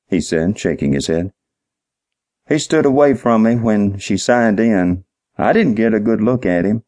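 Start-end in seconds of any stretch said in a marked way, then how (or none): 2.5-6.8 higher-pitched, simple-minded witness, earnestly explaining; dim-witted night manager. Acted. Clerk explains the clock to the detective, dim-witted and earnest.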